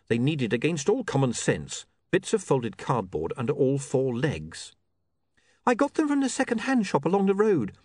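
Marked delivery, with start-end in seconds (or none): 5.7-7.8 put-on high-pitched, mildly anxious young woman; understated nerves in a high voice; nervous. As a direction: explain it high, a touch unsure. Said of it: "said Saccharissa nervously".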